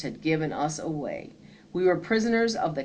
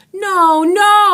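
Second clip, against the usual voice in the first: much higher, louder desperate pleading; begging cry; 13 semitones higher, 12 dB louder than usual. A narrator's reading. Boy being dragged into Andersonville, desperate begging. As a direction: cry out as they drag you. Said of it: "I begged".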